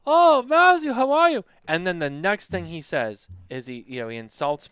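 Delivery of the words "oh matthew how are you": high, loud mock-friendly hello; big gushy put-on welcome; gushing greeting. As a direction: imitate an over-effusive old pal greeting.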